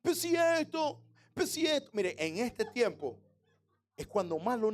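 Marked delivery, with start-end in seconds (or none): 0.0-1.9 put-on whiny teen. Teen grumbling at being told to wash up.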